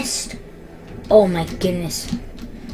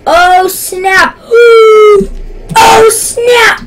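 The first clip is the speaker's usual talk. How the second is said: high, loud startled outburst; shocked shouting; 8 semitones higher, 6 dB louder, slower than usual. Real, not acted. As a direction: cry out, building in shock. Alarmed reaction in game.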